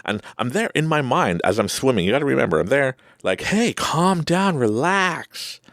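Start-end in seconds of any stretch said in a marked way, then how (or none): 3.4-5.6 high, stretched-out calling; urgent self-calming. Retold or imitated. Mind to panicking body while swimming, drawn-out pleading call. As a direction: call out slowly, pitched high.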